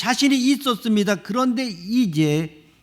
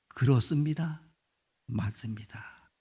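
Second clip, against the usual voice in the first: humble quiet answer; 5 semitones lower, 7 dB quieter than usual. Acted.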